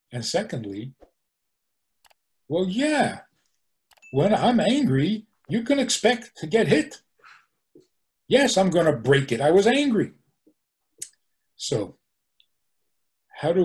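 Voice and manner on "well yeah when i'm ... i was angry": higher-pitched, unapologetic acted protest; mock defiant justification. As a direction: play someone brazenly blaming their temper. Acting out person justifying violence.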